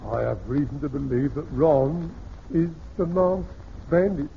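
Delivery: ordinary talk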